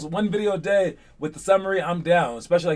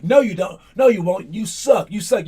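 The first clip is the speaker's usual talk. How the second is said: mocking taunt; 3 semitones higher, 5 dB louder than usual. Voicing doubters taunting him.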